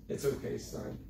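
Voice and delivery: hushed, barely voiced reassurance; soft consoling. Preacher acting the soft dad his father wasn't, hushed. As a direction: murmur it gently, like comforting a child.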